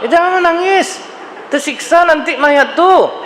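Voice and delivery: high, mocking nag telling mourners to stop crying; mock scolding voice. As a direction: parody the busybody who forbids tears. Mocking what people wrongly say.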